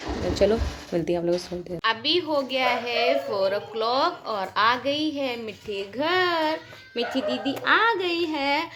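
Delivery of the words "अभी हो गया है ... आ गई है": sing-song announcing. Real, not acted. Sing-song announcing sister's arrival.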